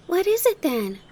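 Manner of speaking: put-on high, eager child's question; high-pitched urgent curiosity; crying out. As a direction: cry it out like an excited little girl. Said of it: "cried Heidi".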